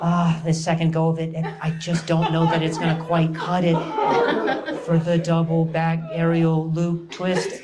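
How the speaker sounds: lightly voiced skeptical sports announcer; doubtful announcer. Comedian mocks gymnastics announcers, lightly voiced doubtful tone. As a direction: sound unconvinced, like a gloomy commentator. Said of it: "they always have this tone".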